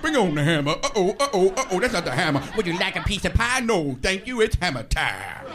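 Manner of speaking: loud put-on character voice; comic character. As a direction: play it big and silly. Sketch of Hammer gag at home.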